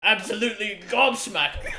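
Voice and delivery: mock British slang in a put-on British accent. Joking with friends about British people, in a British accent.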